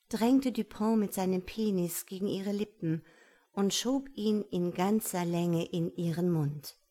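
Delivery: ordinary delivery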